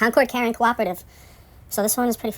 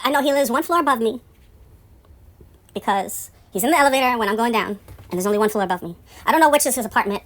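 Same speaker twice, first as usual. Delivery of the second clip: ordinary talk, nothing marked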